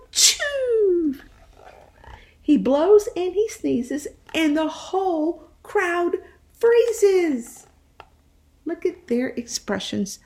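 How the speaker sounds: put-on lively read-aloud voice for young children; animated picture-book reading; storytime narration. A narrator's reading.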